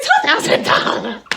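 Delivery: high, loud mock shriek; shocked squeal. Driver hearing the bond amount, shocked squeal. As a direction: squawk it in exaggerated shock.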